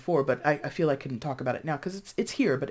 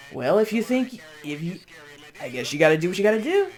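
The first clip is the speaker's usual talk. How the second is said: louder, mock-doubtful friend giving in; reluctant support; 2 semitones higher, 6 dB louder than usual. A friend who won't walk your path with you, reluctant support. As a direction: play a friend grudgingly going along.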